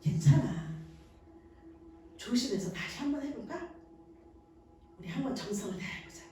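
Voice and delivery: soft, fading encouragement; a mother reassuring a child who spilled food; gentle reassurance. Shown as a demonstration. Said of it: "책망하지 마시고요".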